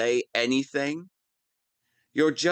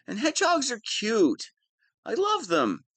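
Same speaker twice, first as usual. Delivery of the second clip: gushing fondness; 12 semitones higher, faster than usual. Acted. Villain gushes over hedgehogs.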